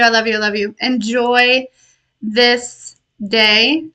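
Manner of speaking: ordinary talk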